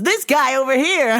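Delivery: high, loud, laughing mock of the old man; mocking customer. Old customer telling the boss about O J, high mocking. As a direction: crow it like he's proud of the joke. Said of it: "And he goes".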